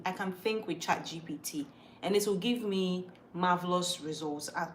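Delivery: normal talking voice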